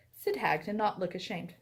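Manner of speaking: usual speaking voice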